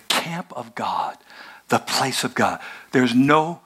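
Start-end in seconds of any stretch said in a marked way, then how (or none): 0.1-2.6 hushed reverent whisper. Real, not acted. Pastor telling congregation of holy place, whispered. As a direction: draw the room in with a whisper.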